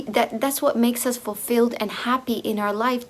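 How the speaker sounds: usual speaking voice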